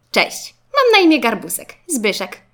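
high, loud, cheery introduction; friendly little classmate. A narrator's reading. Garbusek introducing himself to Zygzak, friendly. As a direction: announce yourself to a new friend.

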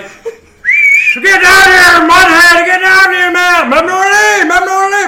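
loud, high shouting of a rowdy crowd; high-pitched, roaring catcalls; crowd hollering. Acted. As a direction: yell it at full force like cheering fans. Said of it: "everyone's like".

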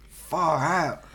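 put-on Australian accent, drawn-out vowels; mock Australian slang. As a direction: say it like a laid-back Australian. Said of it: "I'm gonna talk in Australian accent".

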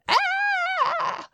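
high squealing mock scream of a kid; fake howl. Boy pretending to be spanked, high fake cry. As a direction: yelp as if the belt really landed. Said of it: "And the kid goes".

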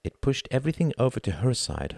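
ordinary delivery

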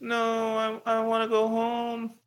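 put-on high, slow whimpering little-kid voice; hammed-up wail of a homesick child; mock crying kid. Him as a kid begging to go home, hammed-up whimper. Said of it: "I was like".

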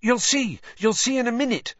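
high, loud, eager promise; excited. A narrator's reading. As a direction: keep the secret with bubbling excitement. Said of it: "said Hermione excitedly".

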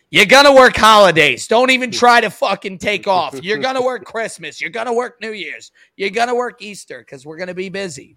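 a lightly voiced bossy manager, loud at first; hammed-up boss barking orders, fading; mock boss. Host imitating a manager banning holidays off, loud then settling.

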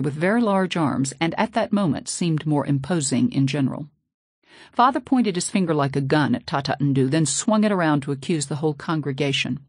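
ordinary delivery, nothing marked

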